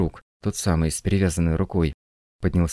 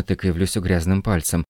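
ordinary delivery

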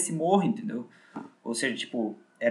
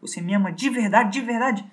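higher, coaxing mock plea; voicing her higher-pitched as she tests his love; pleading girlfriend; 7 semitones higher, 4 dB louder than usual. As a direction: wheedle him like a manipulative lover. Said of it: "Ela fala assim".

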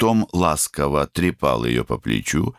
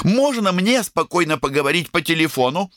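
высокий раздражённый голосок; выгони высоко, с досадой; раздражённая девочка. A narrator's reading. Сестра выгоняет брата. (раздражённый девичий голос)

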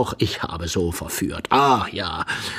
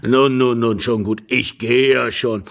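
put-on louder, ponderous old man's voice; placating old husband; 2 semitones higher, 5 dB louder than usual. Old husband agrees to leave, placating. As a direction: give in grudgingly as an old man.

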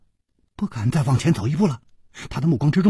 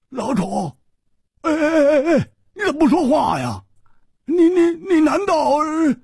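high-pitched panicked stammer; shocked, squealing high, near a sob; alarmed outcry; 14 semitones higher than usual. A narrator's reading. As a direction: cry out in rising alarm, stuttering. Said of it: "他十分吃惊，失声说".

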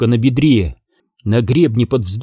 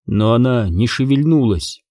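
ordinary delivery, nothing marked

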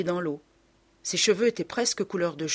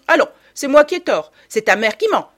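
loud, high, affronted mother; indignant scolding outburst; outraged sarcasm; 7 semitones higher, 10 dB louder than usual. A narrator's reading. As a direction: throw the accusation back at the child. Mother outraged at being contradicted.

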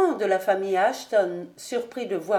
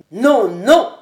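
loud furious refusal; cry out; 2 semitones higher, 12 dB louder than usual. A narrator's reading. Colonel refusing Ravenswood's plea, shouting. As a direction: shout him down. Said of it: "s'écria le colonel".

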